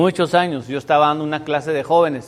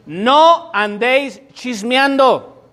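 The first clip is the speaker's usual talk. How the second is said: slow, high, drawn-out scripture warning; solemn command; 5 semitones higher, 3 dB louder, much slower than usual. Acted. Preacher warning the church against gossip. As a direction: lay each word down like a commandment.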